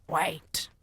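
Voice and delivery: shouting. Acted. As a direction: call out to stop them. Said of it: "she shouted again".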